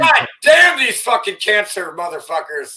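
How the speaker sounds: explodes into a loud, high yell; furious shout. Real, not acted. Streamer yelling at a news clip in a furious shout. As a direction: blurt it out as a real furious outburst.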